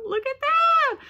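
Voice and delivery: very high-pitched delighted squeal; excited squeal. Real, not acted. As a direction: squeal as you show off a gift. Showing off a cute gift.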